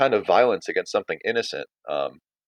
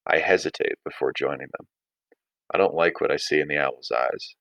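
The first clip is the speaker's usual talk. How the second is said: low, measured read-aloud narration; reading a novel excerpt aloud to students; story reading; 3 semitones lower than usual. A narrator's reading. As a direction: read the story to the class, steady. Teacher reads story aloud.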